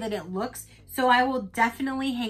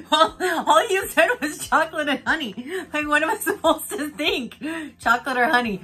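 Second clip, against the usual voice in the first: retold exasperation; 5 semitones higher, 6 dB louder than usual. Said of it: "And I'm like".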